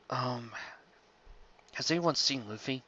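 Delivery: ordinary delivery, nothing marked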